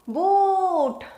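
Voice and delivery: Boot, oo held long. Shown as a demonstration. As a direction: stretch the oo in Boot.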